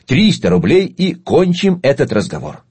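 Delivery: loud, final market haggler's offer; haggling dealer tone. Acted. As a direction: slap down the last price like a dealer. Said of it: "тоном перекупщика". (тоном перекупщика, громко и решительно)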